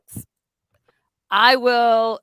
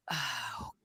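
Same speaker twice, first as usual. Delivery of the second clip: breathy nervous whisper; 6 semitones lower, 14 dB quieter than usual. Real, not acted. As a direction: whisper it in dread. Nervous about beetle on arm.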